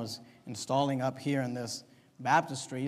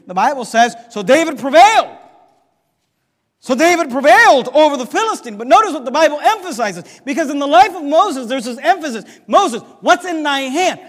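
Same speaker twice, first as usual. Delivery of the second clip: loud, driving preacher's cadence; preaching; 9 semitones higher, 15 dB louder than usual. Acted. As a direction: preach it hard to the congregation. Preacher driving home his point.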